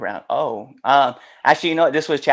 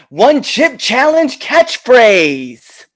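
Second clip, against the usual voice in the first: slow, high, loud announcer call; drawn-out shout; 11 semitones higher, 13 dB louder, much slower than usual. Acted. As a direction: stretch it out like a catchphrase. Drawn-out mock catchphrase call.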